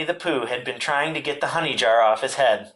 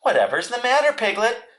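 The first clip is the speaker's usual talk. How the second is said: higher-pitched, surprised question; Christopher Robin; 10 semitones higher than usual. A narrator's reading. Christopher Robin, just getting up, asks Piglet in high surprise. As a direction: ask it high, with concern. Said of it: "said Christopher Robin".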